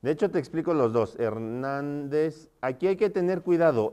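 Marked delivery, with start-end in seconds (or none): 1.2-2.5 slow, softer "Hernández", syllables stretched; drawn-out dictation. Shown as a demonstration. Teacher showing where Hernández takes its accent, drawn-out dictation. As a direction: stretch out "Hernández" as if spelling it while typing. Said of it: "Hernández lleva acento en la A".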